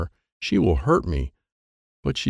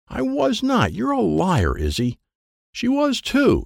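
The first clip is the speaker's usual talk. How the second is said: high protest; 13 semitones higher, slower than usual. Acted. Mary and Izzy quarrel.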